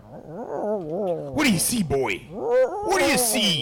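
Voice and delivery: a weak attempt at a dragon voice; bad dragon impression. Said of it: "the worst dragon impression".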